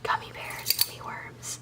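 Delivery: ordinary talk, nothing marked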